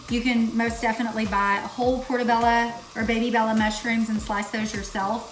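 ordinary talk, nothing marked

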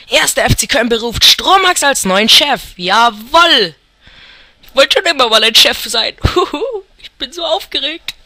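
ordinary delivery

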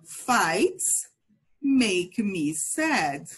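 very slow, clear model for repeating; slow model phrase. Shown as a demonstration. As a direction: drill it slowly, word by word. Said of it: "One more time".

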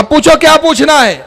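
confident invitation. Retold or imitated. Ali inviting any question, confident and ready. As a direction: throw the door open with confidence. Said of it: "مگر یہ وہ بولتا ہے".

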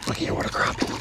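hushed and delighted; excited whisper. Real, not acted. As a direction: whisper it, thrilled. Whispering over second catch.

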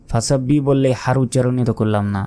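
ordinary talk, nothing marked

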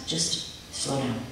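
gently calm her down; soothing mother. A narrator's reading. Mother calming her racing daughter, slow soft soothing. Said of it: "can you slow down".

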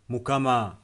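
ordinary talk, nothing marked

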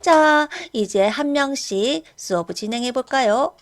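ordinary talk, nothing marked

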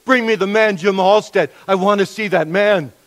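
urgent pleading. Retold or imitated. Dying wife pleads with her husband from hospital, retold urgent pleading. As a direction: imitate a dying wife's urgent demand. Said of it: "she pleaded with her husband".

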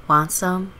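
ordinary talk, nothing marked

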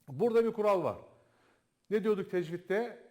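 ordinary talk, nothing marked

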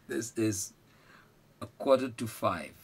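slow, deliberate a quarter to five; slow model phrase. Shown as a demonstration. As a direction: say a quarter to five slowly, stressing quarter. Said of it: "repeated slowly for learners".

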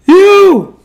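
very high, loud comic yelp; Chris Tucker voice. Pretend. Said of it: "Chris Tucker voice".

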